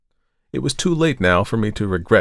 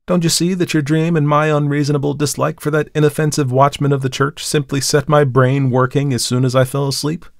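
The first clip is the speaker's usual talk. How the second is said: higher and louder, talking fast past her fear; earnest reassurance; 6 semitones higher, 4 dB louder than usual. Acted. Man talking his frightened girl out of her dread, higher and louder. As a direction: brush it all off as a harmless dream. Narrator explains away his dream.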